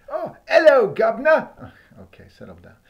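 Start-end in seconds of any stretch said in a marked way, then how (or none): 0.1-1.5 loud hammed-up Cockney greeting; mock British. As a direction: boom it out like a music-hall Brit.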